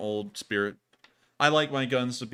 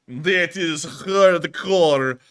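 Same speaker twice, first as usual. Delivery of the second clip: put-on very slow, drawn-out "That is hardcore" in a thick Russian accent; Russian accent tail; 5 semitones higher, 9 dB louder, much slower than usual. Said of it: "with that thick Russian accent".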